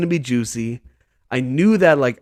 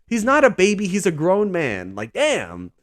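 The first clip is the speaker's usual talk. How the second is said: ordinary talk, nothing marked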